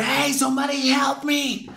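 high slow pleading cry; desperate plea. Retold or imitated. Friend begging bystanders, high and slow. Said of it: "y dice".

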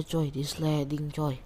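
usual speaking voice

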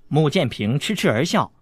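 ordinary delivery

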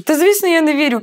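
high, loud sarcastic retort; scornful retort. Retold or imitated. To angry Peter, high loud sarcastic retort. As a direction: snap back that of course not.

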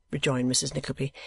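usual speaking voice

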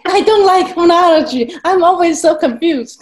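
high, loud laughing confession; real laughter, pitch shooting up; laughing protest. Real, not acted. Laughing confession of disliking phonology.

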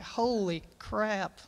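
retold stunned mutter, slowly; stunned disbelief. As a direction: draw it out in quiet amazement. Stunned at son beating brother.

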